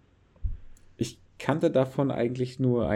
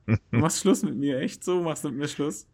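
higher, hammed-up jilted lover; playfully hurt, pitched up; mock heartbroken; 6 semitones higher than usual. Mock breakup with co-host.

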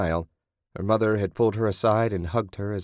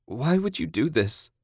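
higher-pitched distressed question; pleading cry; 5 semitones higher than usual. A narrator's reading. Captive begging captor for reasons, pleading. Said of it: "Susan cried".

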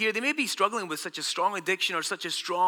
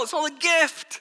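raised, ringing declaration of grace; loud exclamation; 10 semitones higher, 5 dB louder than usual. Real, not acted. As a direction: proclaim it high and loud. Preacher exclaims, then repeats softly.

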